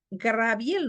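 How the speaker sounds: Gabriel said as "Graviel"; R transposed in name. Shown as a demonstration. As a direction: jump the r forward, "Graviel". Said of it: "no es, es Gabriel".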